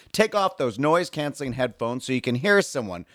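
ordinary delivery

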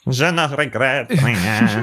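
slow, mock French accent; a touch of French accent, slowed down. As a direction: croon it like a French chanson singer. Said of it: "He said it with a French accent".

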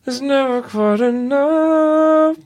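high, slow, lips held still; ventriloquist dummy voice. Pretend. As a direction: sing it as the dummy, jaw tight. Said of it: "Can you sing perfect as Danny O'Day?".

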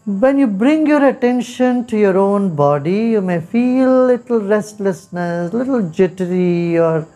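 unhurried meditation guide with long pauses; guided meditation. Acted. Teacher guiding listeners through body, breath and mind, guided meditation. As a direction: lead them gently inward, leaving space after each phrase.

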